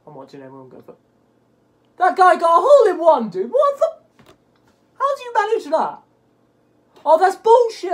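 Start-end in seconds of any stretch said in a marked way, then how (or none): 2.0-7.9 loud, high-pitched ranting; outraged disbelief. Real, not acted. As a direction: protest at the top of your voice, incredulous. Outraged at rival's hole in one.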